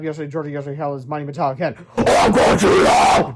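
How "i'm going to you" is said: slow, loud roar; villain roar. Acted. Robot villain grabbing the boys, roaring. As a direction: roar it at them. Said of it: "roared the prepost".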